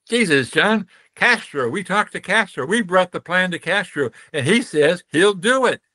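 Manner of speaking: a hint of a Cuban friend bursting with news; excited Cuban buddy. Cuban diplomat tells John that Castro agreed, excited and high.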